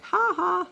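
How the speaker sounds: high taunting "ha ha" from a bird; squawky high mock laugh; mocking parrot laugh. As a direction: mimic a parrot laughing down from a tree. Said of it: "He's like".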